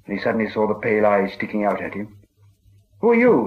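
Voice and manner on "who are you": high, loud startled cry; startled challenge. A narrator's reading. Bilbo, startled, challenges the unseen creature with a sudden high cry. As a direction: jump and challenge the voice in the dark. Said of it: "he said, thrusting his dagger".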